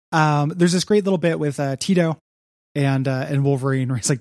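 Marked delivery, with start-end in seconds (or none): none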